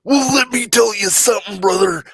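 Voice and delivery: hammed-up wrestler promo growl; wrestling promo voice. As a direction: play a pumped pro wrestler. Wrestler catchphrase impression.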